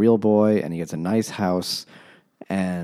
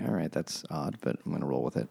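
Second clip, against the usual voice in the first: much softer, lower resigned aside; quiet aside; 4 semitones lower, 9 dB quieter than usual. Retold or imitated. Reader reacting to the translation's odd coins, quiet resigned aside. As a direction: say it under your breath, shrugging it off. Narrator's muttered inner reaction.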